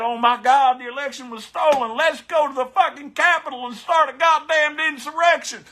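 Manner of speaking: high, loud mock Trumper rant; hammed-up MAGA rant, high and loud. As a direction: ham up an outraged Trumper, voice high and shouting. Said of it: "you want him back so bad".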